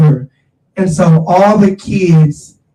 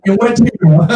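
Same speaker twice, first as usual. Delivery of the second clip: repeating it with a chuckle; amused echo of the words; laughing; 3 semitones higher than usual. Real, not acted.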